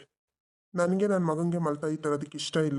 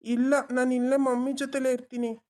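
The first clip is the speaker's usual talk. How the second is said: put-on higher, childlike voice for the son; little boy; 7 semitones higher than usual. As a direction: play the small boy tugging at his dad. Adult voicing the child. (ಪುಟ್ಟ ಹುಡುಗನ ಧ್ವನಿ)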